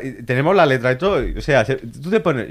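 normal talking voice

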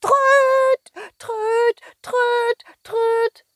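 rapid high trumpeting toots. Acted. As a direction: toot it like a toy horn. Worm trumpets repeatedly.

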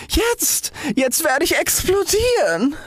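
very high, drawn-out announcement; excited cry. A narrator's reading. Rocket feeling the tingle before exploding, high excited cry. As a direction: cry it high, stretching it toward the bang. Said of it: "rief sie".

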